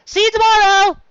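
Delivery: put-on high, loud child's call; a little filly calling out after friends; calling goodbye. Dicky waves goodbye to friends.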